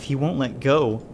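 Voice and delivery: ordinary delivery, nothing marked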